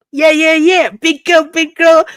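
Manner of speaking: high, loud happy cheer; celebrating. Real, not acted. Vlogger celebrating the subscriber milestone, high happy cheer. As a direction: cheer for yourself.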